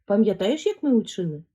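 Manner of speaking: ordinary talk, nothing marked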